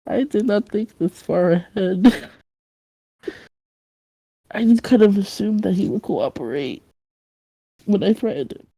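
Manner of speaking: soft, deflated admission to himself; deflated muttering. Acted. Kidnapper slumped against the door talking to himself, deflated muttering. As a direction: mutter it quietly, plan in tatters.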